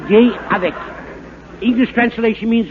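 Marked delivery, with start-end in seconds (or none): 0.1-0.7 mock French. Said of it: "talking in French like this".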